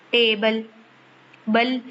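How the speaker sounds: Table said with बल pressed harder; बल emphasized, then repeated alone; stress on बल. Shown as a demonstration. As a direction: lean on बल in table. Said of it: "पे आप लोग देखेंगे कि दबाव डाल रहे हैं".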